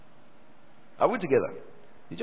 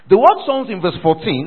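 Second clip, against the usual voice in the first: emphatic preaching; 6 semitones higher, 11 dB louder than usual. Real, not acted. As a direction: spell it out forcefully for a full church. Preacher resumes projected word study.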